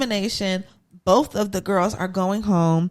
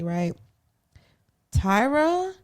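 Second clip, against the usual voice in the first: ordinary talk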